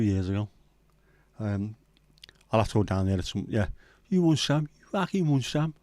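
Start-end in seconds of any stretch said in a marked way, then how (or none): 4.1-5.7 high-pitched mock challenge; put-on football hooligan taunt; Wealdstone Raider. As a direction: goad them in a hammed-up London accent. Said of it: "That little Wheelston Raider".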